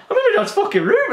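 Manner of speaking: high, disbelieving squeal; a child's startled shriek; shocked realisation. Retold or imitated. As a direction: sell the panic of waking up elsewhere. Realising he sleepwalked.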